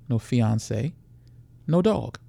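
usual speaking voice

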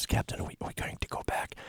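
hushed, voiceless whisper. Retold or imitated. Flight attendant whispering a question to the captain, discreet whisper. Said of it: "and I whispered to him".

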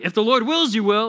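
very high, loud interjection; mock legalist. Legalist interrupting someone's bathroom trip, mock officious. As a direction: pounce with a hammed-up correction.

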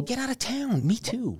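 high, soft disbelieving exclamation; gasping delighted surprise; surprised. Real, not acted. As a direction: act floored by the coincidence. Surprised at shared family story.

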